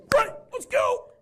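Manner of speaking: very high, slow, loud cheer; mock eager. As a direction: bound off like a thrilled kid. Eager manager.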